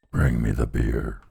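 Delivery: very low, slow hollow skull voice on Bring me the beer; deep skull voice. Shown as a demonstration. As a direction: drop way down and drag Bring me the beer. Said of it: "Tucky Skulls never lie".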